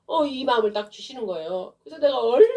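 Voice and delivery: ordinary talk, nothing marked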